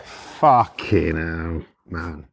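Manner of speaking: very slow, low drawn-out curse; low, dragged-out retold exasperation; deflated disbelief. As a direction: let it sink out in dismay. Retold deflated reaction to answer.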